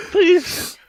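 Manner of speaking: pleading through high laughter; laughing plea. Real, not acted. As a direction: beg while laughing hard. Begging amid big laugh.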